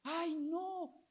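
imitated small child, very high; excited little girl. Little girl thrilled about yogurt, high excited child voice. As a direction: squeal it high like a thrilled four-year-old. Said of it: "Y la niña emocionada".

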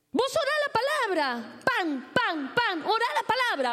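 high, urgent pulpit command; fervent preaching. Real, not acted. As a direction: drive each word into the congregation. Preaches and prays at full force. (predicación ferviente y aguda)

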